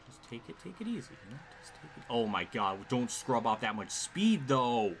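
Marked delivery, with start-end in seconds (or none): none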